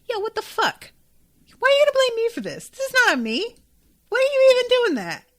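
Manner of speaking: high-pitched, loud mock outrage; indignant protest. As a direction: protest being blamed, voice shooting way up. Indignant quote of blamed character.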